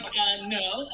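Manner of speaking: loud, appalled no; horrified refusal. Retold or imitated. Son refusing mom's overshare, horrified and loud. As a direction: cut mom off with a loud protest.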